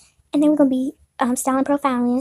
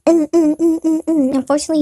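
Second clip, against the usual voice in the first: ordinary delivery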